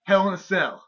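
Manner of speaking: loud wrestling promo announcement; mock match hype. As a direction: announce the showdown like a big event.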